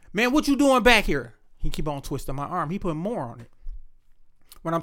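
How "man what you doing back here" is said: cop asking harder. Retold or imitated. Cop repeats while twisting arm.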